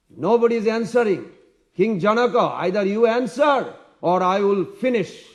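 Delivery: roaring ultimatum, high and loud; Parashuram. Retold or imitated. Warrior threatens the king who will not answer, roaring. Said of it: "like, roaring".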